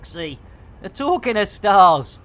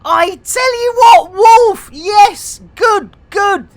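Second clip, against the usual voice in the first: high, loud excited yelling; excited shout; 12 semitones higher, 9 dB louder, slower than usual. Real, not acted. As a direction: cheer for Wolf at the top of your voice.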